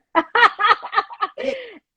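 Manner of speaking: bright burst of high laughter. Real, not acted. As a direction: laugh out loud with relief. Viewer finally answers in chat.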